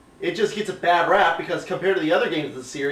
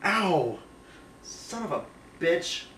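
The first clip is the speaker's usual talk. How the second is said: pained mutter; 10 dB quieter, slower than usual. Real, not acted. Host in pain after hit.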